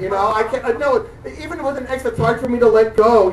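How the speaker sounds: ordinary talk, nothing marked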